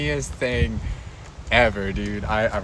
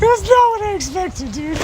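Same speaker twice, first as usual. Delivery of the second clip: laughing squeak. Real, not acted. Building laughter, squeaky high.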